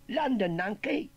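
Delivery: high, loud, drawn-out correction; slow and loud, pitched well up; cheeky Cockney nephew. Acted. Young nephew correcting his uncle, cheeky Cockney voice.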